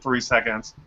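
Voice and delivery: normal talking voice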